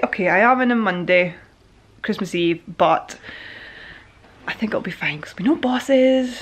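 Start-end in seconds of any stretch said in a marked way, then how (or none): 4.5-6.4 hushed, conspiratorial whisper. Real, not acted. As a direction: let it slip out in a whisper. Whispered aside about work.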